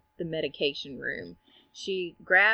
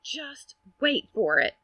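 higher, drawn-out imitation; knowing anticipation; 7 semitones higher than usual. Nurse telling watchers to wait, drawn-out suspense. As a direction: stretch it out, building suspense.

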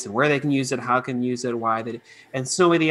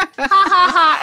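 high, loud, slow ha ha ha; mock kid laugh; 14 semitones higher, 8 dB louder, slower than usual. Kids playing along with the puppet, hammed-up laughing.

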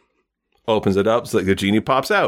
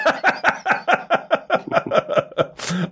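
long high breathless laugh. Real, not acted. Host laughs at guest's answer.

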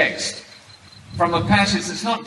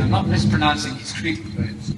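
ordinary talk

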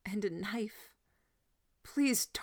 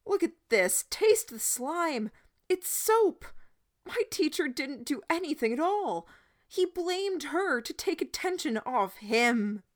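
frantic shouting; 9 semitones higher, 4 dB louder than usual. A narrator's reading. Boy frantically pleading with crowd.

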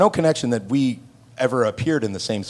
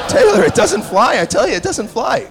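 higher, louder, laughing through it; dad laughing as he corrects his son; laughing insistence; 9 semitones higher, 5 dB louder than usual. Retold or imitated. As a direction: insist while cracking up.

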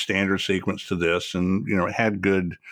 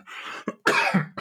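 harsh real hacking coughs; coughing fit; 9 semitones higher than usual. Real, not acted. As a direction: hack out a short coughing fit. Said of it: "Excuse me, I'm coughing".

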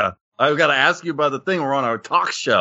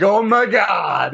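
slow, high, drawn-out imitation of a whiner; hammed-up groaning complaint; mock exasperation; 5 semitones higher, much slower than usual. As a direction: drag it out in mock dismay. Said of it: "And you're like".

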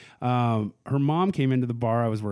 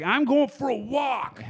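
put-on high, drawn-out drunken announcement; imitated drunk; 12 semitones higher, slower than usual. Tipsy daughter announcing she is leaving, imitated drunk. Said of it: "drank too much and just like".

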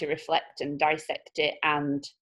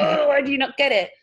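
exasperated; 7 semitones higher, 6 dB louder than usual. Pretend. Frustrated at people online.

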